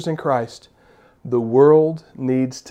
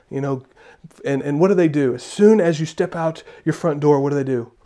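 normal talking voice

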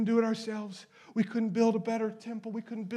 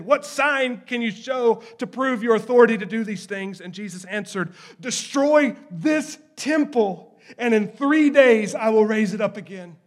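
loud, ringing preacher's reading; forceful scripture reading; 4 semitones higher, 11 dB louder than usual. Acted. Preacher reading John's gospel aloud, loud and proclaiming. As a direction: proclaim the passage boldly.

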